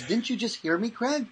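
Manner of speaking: put-on high, complaining twelve-year-old; a hurt boy protesting to his mental coach; whiny kid. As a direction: pitch up into a wounded kid's whine. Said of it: "he goes".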